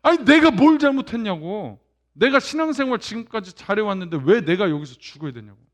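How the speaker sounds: loud angry outburst at God. Retold or imitated. Believer angrily shaking a finger at God. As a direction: shout the complaint at God. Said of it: "막 화내면서".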